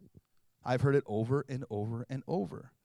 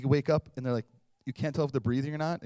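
ordinary talk

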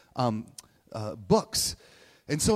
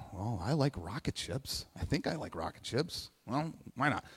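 quiet musing; 8 dB quieter than usual. Retold or imitated. Kid musing over rocket book.

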